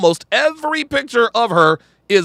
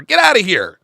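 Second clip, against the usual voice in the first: usual speaking voice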